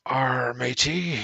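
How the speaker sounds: booming drawn-out arr, matey; hammed-up pirate hail, loud and slow; loud slow pirate greeting. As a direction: bellow it slowly like a pirate captain.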